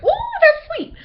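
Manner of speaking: squealed high and loud with a laugh; mock customer reaction. Bojangles customer tasting the tea, high squeal. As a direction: shriek it like a sugar shock. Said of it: "It's like".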